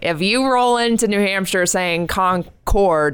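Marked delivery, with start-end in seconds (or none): 2.1-3.1 "Concord" as CON-cord, slowly split; wrong outsider pronunciation. Shown as a demonstration. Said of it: "you're gonna get roasted".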